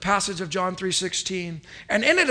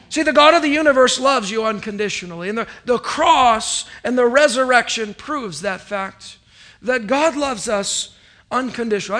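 louder, faster preaching push; 3 semitones higher, 5 dB louder than usual. Acted. Pastor urging congregation God loves them, fervent preaching. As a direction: drive the point home to the church.

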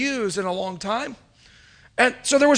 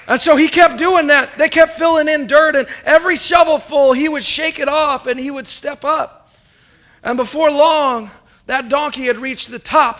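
louder and higher as the climax builds; building excitement; 4 semitones higher, 6 dB louder than usual. Acted. Preacher building the donkey story to its triumph, excited. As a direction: drive the story upward to its triumph.

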